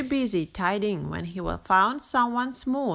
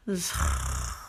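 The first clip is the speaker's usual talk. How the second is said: startled gasp; 5 dB quieter than usual. A narrator's reading. Rabbit startled by duckling.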